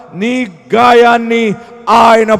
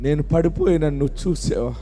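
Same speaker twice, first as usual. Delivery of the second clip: low, much softer prayerful preaching; hushed prayer; 7 semitones lower, 6 dB quieter than usual. Real, not acted. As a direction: drop into a quiet prayer voice. Soft prayerful passage. (మెల్లగా, తక్కువ స్వరంతో ప్రార్థన)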